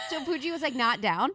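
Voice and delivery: laughing through the words; giggling as she tells it; talking through real laughter. Real, not acted. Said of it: "It was just so funny".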